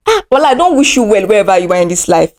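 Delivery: ordinary talk, nothing marked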